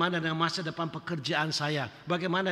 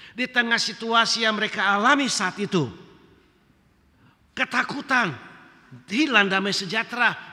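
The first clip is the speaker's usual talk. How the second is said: raised, louder preaching voice, pressing each phrase; impassioned preaching; 5 dB louder than usual. Acted. Pastor urging the congregation with rising fervour. Preacher building to full force.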